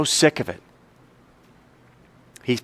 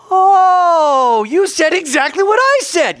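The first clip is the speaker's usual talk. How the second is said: high-pitched, drawn-out "Oh" of discovery; acted astonished outburst; sudden realization; 5 dB louder, slower than usual. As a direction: burst out amazed as it clicks, voice way up. Student suddenly understands the lesson.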